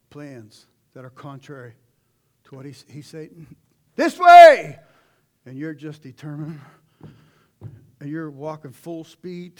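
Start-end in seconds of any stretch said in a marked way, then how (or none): 4.0-4.8 a huge, high shout of warning; booming call. Retold or imitated. God shouting to someone heading for a cliff, booming call. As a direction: yell it across a field. God calling a wayward walker.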